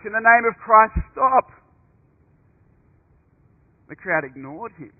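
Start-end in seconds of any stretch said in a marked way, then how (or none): 0.0-1.5 shouted high and loud, pleading; loud cry. Retold or imitated. Monk shouting to the arena crowd, loud desperate cry. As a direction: cry out over the crowd to stop the fight. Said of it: "cried out in a loud voice".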